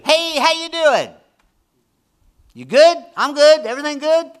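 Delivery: very high, loud, syrupy hello; sugary friendly greeting. Retold or imitated. Pastor meeting the person who hurt him, high loud sweet greeting. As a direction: greet them sweetly as if nothing happened. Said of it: "And when I do".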